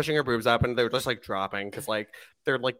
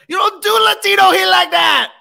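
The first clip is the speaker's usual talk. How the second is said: high, loud wrestling promo yell; wrestler shout; 11 dB louder than usual. Retold or imitated. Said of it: "he's like".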